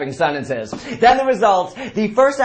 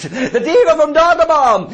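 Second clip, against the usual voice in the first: mock diva; 8 semitones higher, 4 dB louder than usual. Mocking the singer's face.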